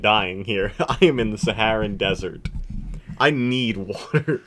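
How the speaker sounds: ordinary delivery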